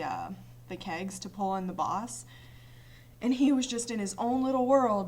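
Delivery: normal talking voice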